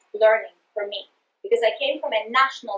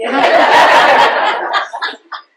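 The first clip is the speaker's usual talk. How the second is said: a big unvoiced burst of laughter; loud open laugh; 2 semitones higher, 11 dB louder than usual. Real, not acted. Speaker laughs at her own accent joke, loud laughter.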